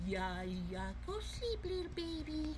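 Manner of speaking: very soft, hushed bedtime murmur; soft lullaby voice. Pretend. Putting the toys to bed, softly hushed. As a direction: lull them gently to sleep. Lulling toy to sleep.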